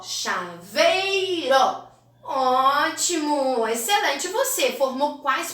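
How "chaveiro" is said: "Chaveiro" stretched slowly as cha, vei, ró; syllable by syllable. Shown as a demonstration. Teacher completing a word for pupils, "Chaveiro" slowly syllable by syllable. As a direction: sound out "Chaveiro" very slowly, each syllable held. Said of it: "Vou completar com vei e com uró".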